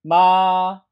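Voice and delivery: MA held high, level and loud; first tone. Shown as a demonstration. Teacher models tone one for students, high level 마. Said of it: "전체적으로 일 성".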